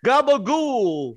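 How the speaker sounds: slow, high mock Long Island accent on Gabagool. Cohost mocking the host's Santa as Long Island Gabagool. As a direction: stretch Gabagool out like a New York Italian. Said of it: "That's what it sounded like".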